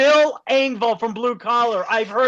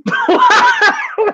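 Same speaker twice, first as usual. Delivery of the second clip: loud, shrieking high laugh; very high, loud cackle of delight; real laughter; 6 dB louder than usual. Real, not acted. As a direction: burst out laughing at a friend's bit. Laughs at other's soup bit.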